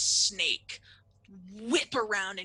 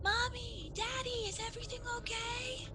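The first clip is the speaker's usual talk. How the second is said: put-on very high little-kid voice; high, timid child calling; small child; 4 dB quieter than usual. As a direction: softly call out to parents as a small child. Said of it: "So you hear".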